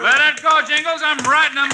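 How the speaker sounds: high, bold heroic shout; cocky cowboy daring the wild horse; brash old-fashioned hero bravado. Acted.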